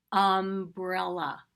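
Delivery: umbrella said slowly and clearly; slow clear word. Shown as a demonstration. Teacher modeling the key word slowly. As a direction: stretch umbrella out. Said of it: "you shout out the word".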